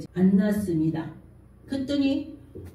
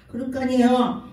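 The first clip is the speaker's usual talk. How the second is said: usual speaking voice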